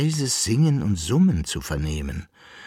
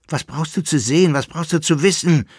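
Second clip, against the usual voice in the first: high, louder, insistent questions; urgent remonstrating; 9 semitones higher, 6 dB louder than usual. A narrator's reading. Jäckele-Narr urges curious companion away, insistent and raised. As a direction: press him hard to drop it. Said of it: "redete der Jäckelnarr auf ihn ein".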